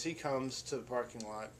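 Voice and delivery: ordinary talk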